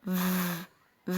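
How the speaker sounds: ف as a sustained buzzing "vvv"; sound of V. Shown as a demonstration. Teacher showing how V is pronounced, held sound. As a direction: isolate ف as v, lip on teeth. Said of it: "حرف الفي بتنطق".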